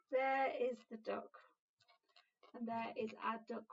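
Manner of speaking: ordinary delivery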